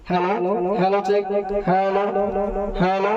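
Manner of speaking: hello check through an echoing amplifier; mic test with strong reverb. Shown as a demonstration. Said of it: "দেখুন এটা কি সুন্দর ইকো হয়".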